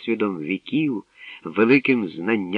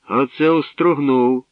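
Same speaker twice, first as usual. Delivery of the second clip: laughing; 6 semitones higher, 5 dB louder than usual. A narrator's reading. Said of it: "залився сміхом Тирло".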